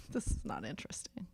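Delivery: breathy self-mocking whisper; whispered aside. Real, not acted. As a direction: whisper it to yourself with a laugh. Embarrassed aside about boring breakfast.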